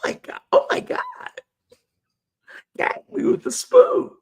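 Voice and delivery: hammed-up high valley girl gasp. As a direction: squeal it like a dramatic teen. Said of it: "Sound like a sixteen-year-old Valley girl".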